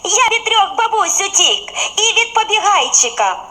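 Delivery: high, laughing boast; cheerful bun. A narrator's reading. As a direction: brag with a laugh through it. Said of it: "весело крутнувся". (колобок весело сміється, хвалиться)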